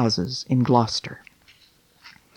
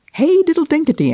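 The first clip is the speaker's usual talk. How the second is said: much higher, squeaky Hey diddle dinkety, falling off; louder cheeky mouse chant; mice answering; 4 dB louder than usual. Acted. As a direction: squeak it back at the cat. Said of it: "answered the little mice".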